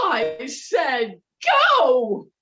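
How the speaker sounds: loud, slow bark; furious order. Acted. Wife ordering the fisherman out, loud angry.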